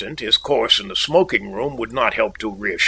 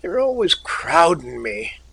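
querulous; 5 semitones higher than usual. A narrator's reading. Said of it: "His voice was querulous".

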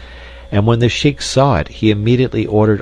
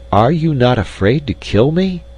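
higher, feigned surprised question; mock surprise; 7 semitones higher than usual. A narrator's reading. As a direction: ask it with pretend astonishment, pitched up. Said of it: "as if surprised".

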